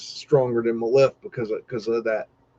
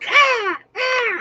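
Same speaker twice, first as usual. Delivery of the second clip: very high imitated bird screech; hawk screech. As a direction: shriek it like a diving hawk. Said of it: "those waitresses come in there like freaking hawks".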